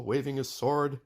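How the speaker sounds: ordinary delivery, nothing marked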